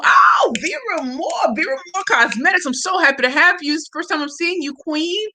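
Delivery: high, thrilled exclamation, then excited gushing; delighted surprise. Real, not acted. Host sees an admired guest for the first time, thrilled. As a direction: burst out with "oh", stay high and thrilled.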